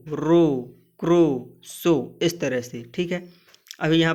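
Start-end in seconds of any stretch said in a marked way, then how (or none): 0.1-2.2 रो, क्रो, सो each held on a long 'o'. Shown as a demonstration. Teacher drilling learners on रो, क्रो, सो, long O. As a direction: list रो, क्रो, सो word by word, O drawn out. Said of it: "ओ दीर्घ उच्चारण में पढ़ा जाता है".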